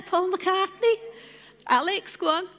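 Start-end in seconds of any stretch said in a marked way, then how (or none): 0.0-1.0 higher, louder, with a hint of Scouse; Scouse accent. Real, not acted. Repeating a famous name to the congregation, touch of Scouse. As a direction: lean lightly into a Liverpool accent. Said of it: "bit of a Scouse accent there".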